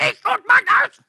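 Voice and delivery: sudden loud high cry of the slogan; hammed-up shouted family motto; mock shout. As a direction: bellow it like rousing the house. Mock loud parent wake-up call.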